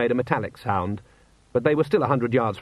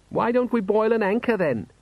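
cheeky son; 10 semitones higher than usual. A narrator's reading.

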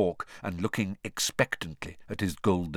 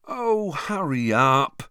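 slow impatient moan, higher and louder; 7 semitones higher, 6 dB louder, slower than usual. Acted. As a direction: whine it out, starving and bored. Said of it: "Ron moaned".

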